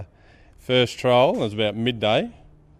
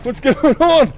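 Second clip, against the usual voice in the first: very high, loud comic howl; reenacted wail of dismay; 8 dB louder, slower than usual. Angler as the fish falls back in, loud high wail. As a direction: cry out in hammed-up horror.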